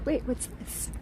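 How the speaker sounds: whispered, curious, under the breath; hushed whisper. Real, not acted. Streamer spotting something on the wall, whispering. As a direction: lean in and whisper it.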